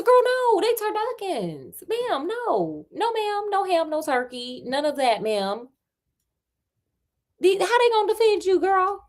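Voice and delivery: high-pitched incredulous sass; sassy sister. Acted. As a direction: squeal it like a scandalised girlfriend. Sister voiced with sassy outrage.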